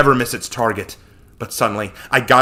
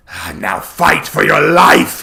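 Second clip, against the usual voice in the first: taunting cry; 2 semitones higher, 4 dB louder, slower than usual. Acted. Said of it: "he cried".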